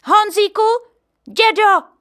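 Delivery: put-on mother calling to her boy and grandpa; relieved cry. A narrator's reading. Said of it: "vykřikla ulehčeně".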